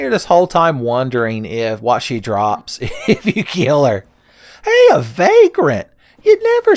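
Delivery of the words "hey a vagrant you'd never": excited. Real, not acted. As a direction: shout it out like a lucky find. Spots a rare enemy.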